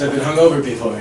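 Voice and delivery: ordinary talk, nothing marked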